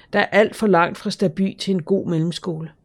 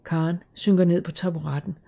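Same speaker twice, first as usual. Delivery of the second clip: ordinary delivery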